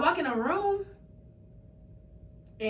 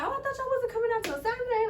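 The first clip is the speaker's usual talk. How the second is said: much higher startled retelling; high surprised voice; 12 semitones higher, faster than usual. Retold or imitated. Daughter to mom on phone, high surprised voice. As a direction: squeak out the surprise. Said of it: "And I was like".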